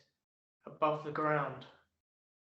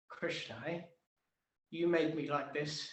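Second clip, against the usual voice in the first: normal talking voice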